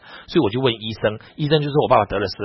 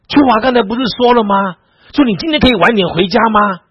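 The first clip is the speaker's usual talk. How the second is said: high, loud challenging question; loud, high-pitched imitation of a baffled friend; incredulous retort; 11 semitones higher, 9 dB louder than usual. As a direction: act out a friend protesting in disbelief. Retold friend challenges him at party.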